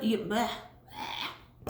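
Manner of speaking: tongue tripping, slow and soft; stumbling over a swollen tongue; with a sore tongue. Real, not acted. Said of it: "I can't talk today".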